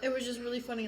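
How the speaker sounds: ordinary delivery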